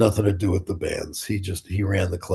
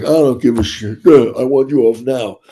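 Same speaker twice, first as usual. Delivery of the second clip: angry bouncer; 5 dB louder than usual. Retold or imitated. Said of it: "And he goes".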